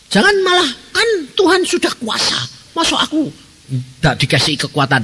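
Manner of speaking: high, mocking voice of a doubter. Preacher mocking a believer's excuse in a high voice.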